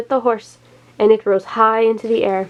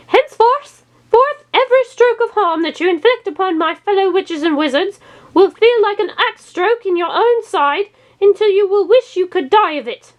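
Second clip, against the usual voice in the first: witch's curse; 10 semitones higher than usual. Acted. Stump witch pronounces curse.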